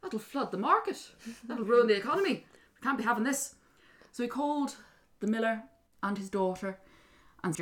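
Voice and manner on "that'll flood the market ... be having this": higher, indignant objection; king in a mild panic; alarmed king. Acted. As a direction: fret aloud like a put-out ruler. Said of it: "king thought".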